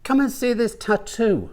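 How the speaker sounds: high, light woman's voice; eager invitation in a high voice. Acted. Woman invites Mama to look, high voice.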